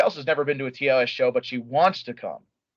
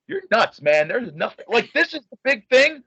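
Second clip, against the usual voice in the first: mock disbelief; 8 semitones higher than usual. Retelling his reaction to Simon.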